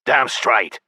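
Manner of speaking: self-satisfied creature voice; satisfied goblin. A narrator's reading. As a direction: agree smugly as the goblin. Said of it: "he said in satisfaction".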